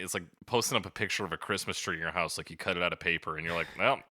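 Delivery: ordinary talk, nothing marked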